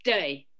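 "Dirty" with the T almost gone; "Dirty" as DUR-ee, London accent; dropped T. Shown as a demonstration. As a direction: say "Dirty" London-style, swallowing the T. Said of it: "the T is almost not there at all".

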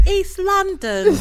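high, stretched-out mimicry, rising at the end; drawn-out imitation. Imitating the girlfriend answering on demand, high drawn-out mimicry. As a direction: imitate her reply, loud, high and dragged out. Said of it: "That's how she said it".

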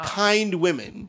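very slow, deliberate "Kind women."; slow deadpan. Shown as a demonstration. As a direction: draw out "Kind women." word by word. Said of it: "W O M Y N kind".

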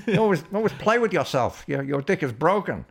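mocking ex-wife. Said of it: "Cactus Jack".

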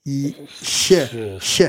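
"sh" said with the tongue held as for Y; Y-position ch sound. Shown as a demonstration. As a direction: glide from "Y-" into a palatal "sh". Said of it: "So hold your tongue like you're gonna say Y, but then say C H".

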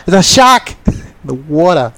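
put-on Australian accent. Repeats his mock Australian accent for his co-host. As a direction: lighter replay of the hammed-up Australian, "shark" stretched. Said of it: "you should hear his Australian accent".

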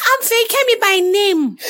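high, slow and loud; high put-on voice. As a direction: draw it out in a prissy voice. Acting out flirty introduction.

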